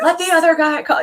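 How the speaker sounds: loud, high, rapid mock heckle; fast sideline shout in imitation; imitated yell. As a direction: holler at the ref across the court. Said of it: "he'll yell out".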